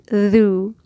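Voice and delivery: thu said with rounded, protruding lips. Shown as a demonstration. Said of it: "round your mouth and protrude your lips".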